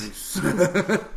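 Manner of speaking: slow, high, laughing Alfred impression; bad butler voice, drawn out; Michael Caine impression. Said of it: "Worst Michael Caine impression you'll ever hear".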